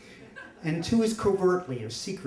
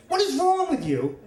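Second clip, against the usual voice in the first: high-pitched mock outrage; outraged mother; 14 semitones higher than usual. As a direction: scold in a high, appalled voice, imitating mom. Retells mother's outraged reaction.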